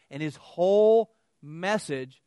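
whole stretched slow and loud; stress on whole. Retold or imitated. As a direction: lean hard on whole. Retold outrage at sermon.

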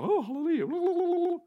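high, drawn-out warbling hallelujah; sing-song it in mock heavenly rapture; mock instant hallelujah. Preacher mocks waking up suddenly gifted, high drawn-out hallelujah. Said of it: "wake up and go".

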